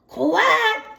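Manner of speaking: squeaky duckling quack; high little quack. Acted. Daisy greeting her brother with a high quack. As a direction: quack back like a tiny duck. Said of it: "said Daisy".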